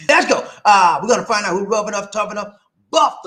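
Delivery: loud, high announcer shout; hype catchphrase. Pretend. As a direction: kick it off like a hype man. Restarts the show's catchphrase.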